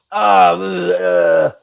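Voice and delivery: loud, low retching groan; loud disgusted "bleh" after a shot; moonshine burn noise. Retold or imitated. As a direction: imitate men reeling from a harsh swig. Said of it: "everybody's making this noise".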